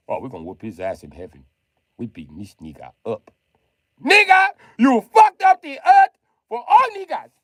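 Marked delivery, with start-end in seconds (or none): 4.0-7.3 high, loud, angry yell; full-throated mock rage; acted furious shout. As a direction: scream the blame at him. Acted beating scene in heaven.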